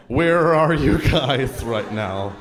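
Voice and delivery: drawn-out, slow mocking read; mock texting voice. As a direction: read the text aloud slowly, poking fun. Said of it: "I don't even know how you do it".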